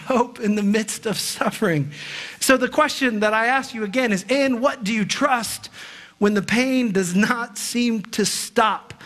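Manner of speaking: ordinary talk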